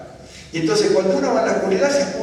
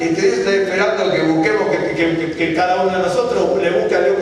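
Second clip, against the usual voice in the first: ordinary talk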